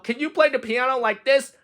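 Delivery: high-pitched, showing off; boastful cry. Acted. Toad shows off his piano playing to Frog, high cry. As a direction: ask it high, proud of yourself. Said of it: "cried Toad".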